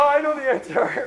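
high eager show-off; a mock know-it-all piping up. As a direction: play the smug guy who knows the answer. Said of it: "He's gonna go".

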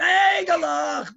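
high, very slow drawn-out shout; peddler's scream. Shown as a demonstration. Father demonstrates the peddler's cry, slow and high. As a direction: show them how it's screamed. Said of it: "No, scream".

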